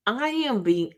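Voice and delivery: ordinary talk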